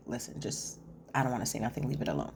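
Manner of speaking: whispered and low, hushing a friend; low secretive whisper; hushed whisper. Retold or imitated. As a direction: keep it down, almost a whisper. Retelling Alicia hushing Rosie.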